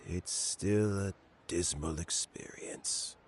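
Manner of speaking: slow, hushed and dejected; sad slow whisper. Acted. Old man lamenting a friend's betrayal, slow whisper. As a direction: let the words fade out in a whisper.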